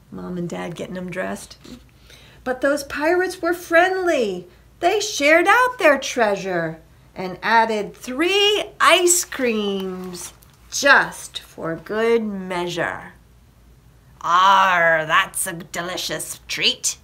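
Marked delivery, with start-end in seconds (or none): 2.4-13.1 lilting, slowing, drawn-out reveal; happy story narration. A narrator's reading. Reader reveals pirates share treasure with boys, happy narration. Reads a picture book. 14.2-17.0 lightly voiced pirate, loud opening growl. Reader comments on the ice creams, mild pirate voice.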